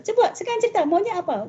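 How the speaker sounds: high, pressing parent demand; a touch of a pushy parent. Shown as a demonstration. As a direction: push the child to explain, mildly impatient. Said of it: "Bukan begitu gitu ya".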